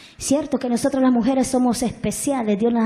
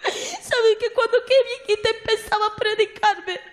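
llorando; 8 semitones higher than usual. Real, not acted. Llora al contar su testimonio.

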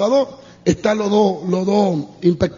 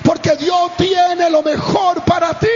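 high, shouted preaching, drawn out; fervent preacher's exhortation; preaching at full force; 6 semitones higher than usual. Acted. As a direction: drive the lesson home to the crowd. Preaching climax to congregation.

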